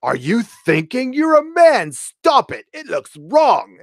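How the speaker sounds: high, loud, drawn-out mock scolding; hammed-up nagging, stretching words for ridicule; mocking River Song. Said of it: "where she's talking to Capaldi's".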